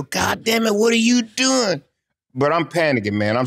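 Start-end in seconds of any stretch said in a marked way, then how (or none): 0.0-1.8 high, exasperated yelled cursing; cussing out. Retold or imitated. Timekeeper cusses out the referee who jumped out, high angry yell. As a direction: yell at him like he lost his mind. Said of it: "He's cussing me".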